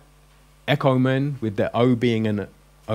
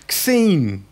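mock street talk; 7 semitones higher, 3 dB louder than usual. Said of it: "you'll be going".

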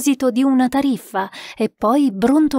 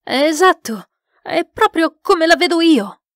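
higher, raised-voice agreement; eager cry; 7 semitones higher, 4 dB louder than usual. A narrator's reading. Sitter agrees with the spirit, crying out eagerly. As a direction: call out in keen agreement. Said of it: "gridò Moir".

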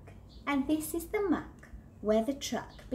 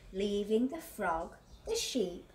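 plain reading voice

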